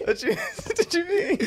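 laughing squeak. Real, not acted. Teasing reaction, laughing.

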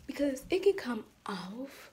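whispered aside. Real, not acted. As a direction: whisper it to the camera.

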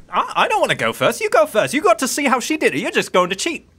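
high, loud, fast protest; bickering sister. Acted. Sister refusing to go first, bickering. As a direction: argue back in an accusing squabble.

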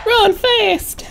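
high frantic command; panicked urging. Real, not acted. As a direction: yell to yourself to flee. Fleeing monster.

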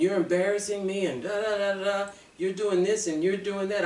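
imitating the abuser's ranting, half-hearted; lightly acted angry shouting; retold yelling. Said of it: "he's screaming and cussing".

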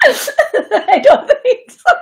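squealing laugh. Real, not acted. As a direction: let the giggle break into a squeal. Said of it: "I laugh when I get nervous".

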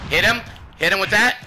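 loud, high shouted urging; urgent shout. Real, not acted. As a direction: bark it at a teammate mid-fight. Urging teammate during fight.

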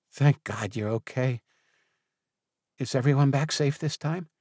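a worried friend asking after everyone; relieved friend. A narrator's reading. Jen hugging Jack, relieved and anxious about the others.

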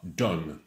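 "Done" with one pure vowel, falling; no diphthong. Shown as a demonstration. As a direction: say "Done" without any glide in the vowel. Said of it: "done ne diphtongue pas".

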